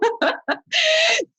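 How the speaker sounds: big high laugh. Real, not acted. Laughing after the group howl.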